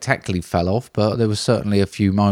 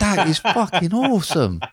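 much higher, genuinely delighted; thrilled; 8 semitones higher than usual. Real, not acted. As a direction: gush over the gift. Thrilled by crocheted frog.

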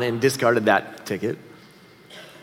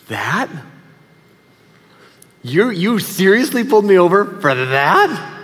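incredulous, drawn-out rising question; shocked disbelief; 4 semitones higher, slower than usual. Retold or imitated. Driver to the officer, shocked and appalled disbelief. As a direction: play the stunned driver who can't believe it. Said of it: "I was shocked and appalled".

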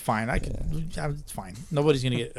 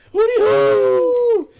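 very high, loud drawn-out hoot; long falsetto team cheer; owl call; 8 dB louder, much slower than usual. Pretend. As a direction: whoop it out like an owl. Owl call after team name Owls.

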